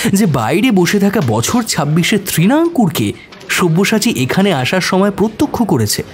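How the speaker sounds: ordinary talk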